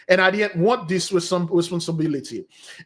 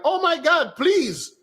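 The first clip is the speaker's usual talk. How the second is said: much higher, pleading shop owner; lightly played panicked shop owner, pitched way up; mimicking the pleading owner; 10 semitones higher than usual. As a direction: squeak it out like a startled woman begging. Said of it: "I heard the owner like".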